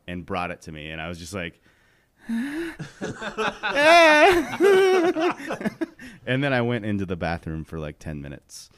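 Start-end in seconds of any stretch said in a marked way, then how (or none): 2.2-6.2 very high, loud laugh that breaks into mock crying; laughing sob. Recalling the fright to friends, with a high laughing sob. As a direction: cackle helplessly until it turns into a whimper. Recalling terror after dog bite.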